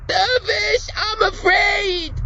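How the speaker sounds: put-on high, slow terrified child's cry; frightened scream. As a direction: scream it in terror. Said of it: "Then Billy screams".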